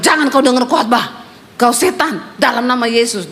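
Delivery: ordinary talk, nothing marked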